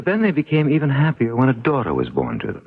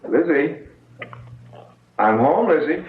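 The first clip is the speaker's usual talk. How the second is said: calling out; 6 semitones higher than usual. Acted. Owner calling cat from downstairs.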